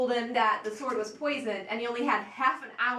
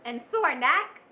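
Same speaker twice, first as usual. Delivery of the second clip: bright upbeat ad voice, higher; chirpy old sitcom mom; 3 semitones higher, 3 dB louder than usual. Acted. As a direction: sell it like a cheery housewife. Parody commercial ad read.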